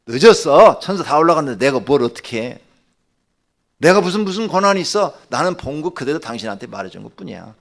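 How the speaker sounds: loud acted rebuke, easing toward the end; reenacted outburst of exasperation; stern refusal. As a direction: shut down a plea forcefully. Acted angry refusal of plea.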